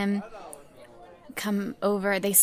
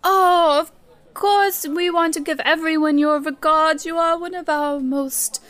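higher, gushing manager voice; effusive theater manager; 3 semitones higher than usual. Acted. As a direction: flatter a rich patron lavishly.

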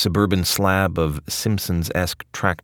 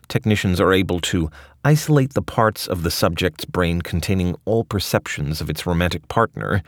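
ordinary delivery, nothing marked

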